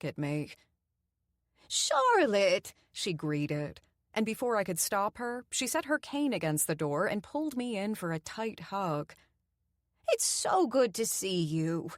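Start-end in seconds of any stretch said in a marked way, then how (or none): 1.7-2.7 high, loud happy cry of a name; grandmotherly welcome, higher and louder; delighted greeting. A narrator's reading. Doreen greets warmly. 10.1-11.9 slow, lightly voiced old lady; a hint of a sweet old woman. A narrator's reading. Elderly Doreen voiced.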